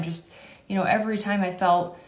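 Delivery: ordinary delivery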